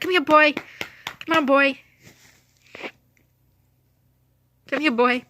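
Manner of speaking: calling the dog. Retold or imitated. Owner running outside to fetch her dog, calling out. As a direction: call out eagerly to bring a dog over.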